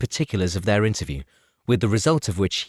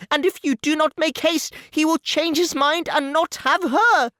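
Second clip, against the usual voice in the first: much higher, urgent and flustered; frantic mother summoning her husband, much higher; Mrs Bennet calling out. Acted. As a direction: burst in demanding help. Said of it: "called out as she entered the library".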